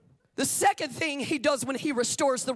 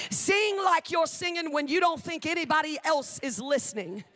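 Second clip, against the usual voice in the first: usual speaking voice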